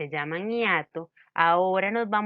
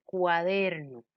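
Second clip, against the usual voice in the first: ordinary talk, nothing marked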